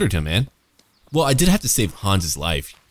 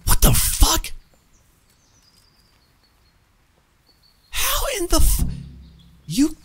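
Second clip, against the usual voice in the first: outraged shriek; slower than usual. Real, not acted. Gamer sees companion captured, disbelieving screech. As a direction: squeal it in disbelief.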